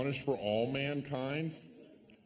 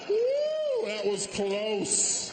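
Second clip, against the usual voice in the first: much higher, louder woo then slow; relieved whoop; 7 semitones higher, 4 dB louder, much slower than usual. Real, not acted. Comic relieved after a risky joke, loud high whoop. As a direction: celebrate escaping the joke. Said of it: "comic relief after joke".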